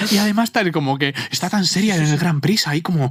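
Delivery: high-pitched, swooning fangirl gasps and praise; imitated adoring fan, easing down towards the end; gushing fan. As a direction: gush like a thrilled fan, far above your own pitch. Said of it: "una fan tuya nos decía".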